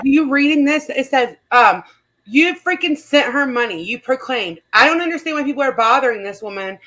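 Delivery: ordinary delivery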